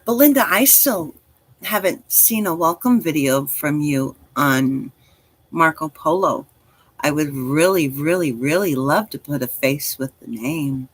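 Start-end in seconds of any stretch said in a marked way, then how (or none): none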